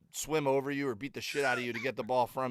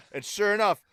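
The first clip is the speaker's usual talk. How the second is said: ordinary delivery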